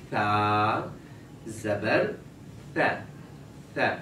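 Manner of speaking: ثا slow, tongue tip on upper teeth; slow model tha. Shown as a demonstration. Teacher slowly shows the child the ثا sound. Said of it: "زبان کی نوک لگنی چاہیے".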